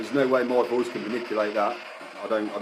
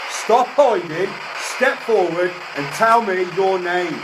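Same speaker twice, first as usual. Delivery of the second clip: forceful command; 7 semitones higher, 3 dB louder than usual. Real, not acted. Ghost hunter demanding a hiding spirit's name, commanding. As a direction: order it out with full authority.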